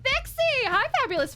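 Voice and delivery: high, loud happy call; excited greeting. Real, not acted. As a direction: call the name out with delight. Greeting chat friend.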